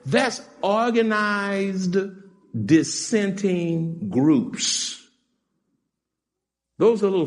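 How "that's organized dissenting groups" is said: very slow emphasis. Acted. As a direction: drag out "dissenting groups" very slowly. Slowly defining a word.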